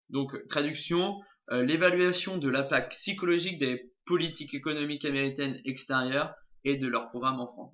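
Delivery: ordinary talk, nothing marked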